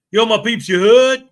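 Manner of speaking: loud, rising finish on a hailed greeting; Eli impersonation. Acted. Streamer reading out a viewer's greeting, Eli impersonation. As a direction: call it out as an impression. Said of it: "Paul Cohen with his Eli impersonation".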